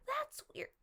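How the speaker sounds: repeated in a high, baffled squeak; incredulous. Real, not acted. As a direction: say it like you can't believe it.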